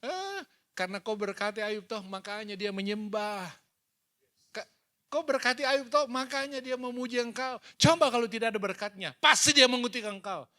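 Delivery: Satan accusing. Acted. Preacher voices Satan accusing God over Job, mock sneer. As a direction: play Satan taunting God, half-acted. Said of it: "iblis selalu datang m-, menuduh".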